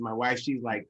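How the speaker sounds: ordinary talk, nothing marked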